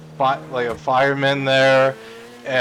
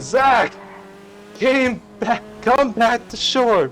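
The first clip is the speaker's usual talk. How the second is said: high, loud, slow call to shore; 11 semitones higher, 4 dB louder, slower than usual. Retold or imitated. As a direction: call him back across the water. Mom calling son back.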